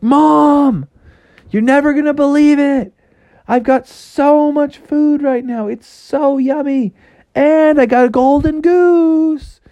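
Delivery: put-on high, loud kid-frog excitement; excited calling. Froggy home with food and goose, excited. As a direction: gush the big news in a high voice. Froggy boasts of his haul.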